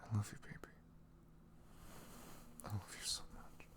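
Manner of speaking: soft, tender whisper; ASMR whisper. Acted. Boyfriend whispering his love to his girlfriend, tender. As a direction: reassure her gently, close to her ear.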